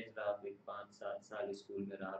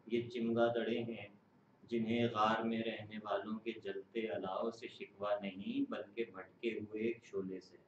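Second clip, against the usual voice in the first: measured, solemn poem reading; stately nazm recitation; 3 dB louder than usual. Acted.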